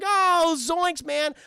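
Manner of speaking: high, dismayed cartoon slacker; Shaggy. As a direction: whine it as a goofy sidekick. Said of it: "She goes like".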